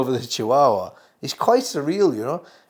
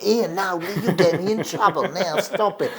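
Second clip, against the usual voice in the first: hoger en luider, lachend berispend; Max Miller scolding audience; 6 semitones higher than usual. Retold or imitated. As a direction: speels de zaal terechtwijzen met een lach. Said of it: "he would sort of blame the audience".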